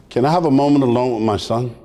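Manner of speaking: low, gentle imitation of dad; ominously sweet and low; sweetest, gentlest voice. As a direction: put on a most beautiful, gentle fatherly voice. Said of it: "comes the sweetest, most gentlest, most beautiful voice".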